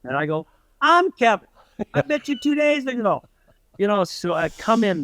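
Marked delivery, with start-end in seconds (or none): 0.8-3.2 high-pitched, urgent retold plea; eager calling out. Storyteller answering the state trooper through the door, eager. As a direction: pipe up high to get noticed.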